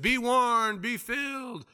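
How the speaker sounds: high, breezy blessing, fading off; mock pious brush-off. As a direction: wave them off with cheap words. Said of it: "all you do is just".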